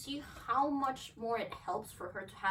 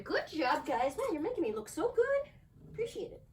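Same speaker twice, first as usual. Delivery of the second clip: much higher thanks to the dogs; high happy thanks to dogs; playful high praise; 7 semitones higher, 3 dB louder than usual. Real, not acted. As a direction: tease them with praise.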